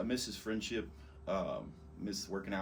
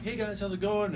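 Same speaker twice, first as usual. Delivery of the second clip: higher-pitched hello to the guys; retold friendly greeting; 11 semitones higher, 4 dB louder than usual. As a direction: call out a casual greeting, pitched up.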